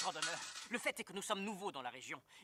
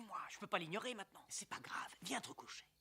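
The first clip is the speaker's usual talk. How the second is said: ordinary talk, nothing marked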